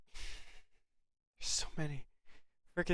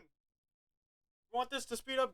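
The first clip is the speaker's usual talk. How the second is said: playful high voice; 9 semitones higher, 4 dB quieter, much slower than usual. Pretend. Talking to timer, playful high.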